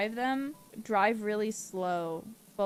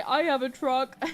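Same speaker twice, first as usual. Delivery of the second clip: louder, higher, slowed mock-defensive viewer; 5 semitones higher, 6 dB louder, slower than usual. Streamer mocking a defensive truck-owner viewer, louder mock protest. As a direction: play the offended truck owner, hammed up. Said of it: "Before you say".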